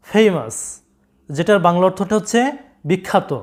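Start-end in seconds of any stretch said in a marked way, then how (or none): 0.1-0.5 ফেমাস as FAY-mus, gliding "ay"; correct pronunciation. Shown as a demonstration. Teacher corrects students' word, correct pronunciation. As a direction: model ফেমাস English-style, falling. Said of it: "সঠিক উচ্চারণটা হচ্ছে".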